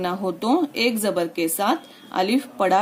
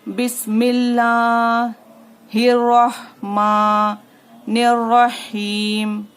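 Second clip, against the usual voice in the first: very slow, louder recited chant; Quranic recitation; 4 dB louder, much slower than usual. Shown as a demonstration. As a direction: recite it long and sung. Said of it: "अब यहाँ पर देखिए".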